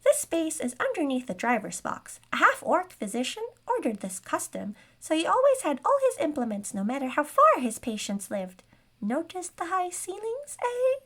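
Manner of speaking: high squeaky gnome showing off; smug gnome. A narrator's reading. Gnome seller boasting about the carriage to his buyer, smug squeak. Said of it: "said the gnome smugly".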